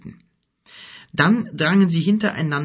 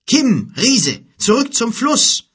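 hoch und laut gerufen; Zuruf; 10 semitones higher, 7 dB louder, slower than usual. A narrator's reading. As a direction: dringend über Lärm hinweg befehlen. Ruft Befehl über Kampf. (die Stimme befiehlt Kim und Gork, laut)

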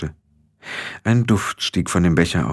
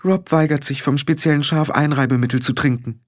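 high-pitched indignant wife; mild high-voiced indignation; sharp; 8 semitones higher than usual. A narrator's reading. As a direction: report it tartly, pitched up. Kelder complains sharply about Rob.

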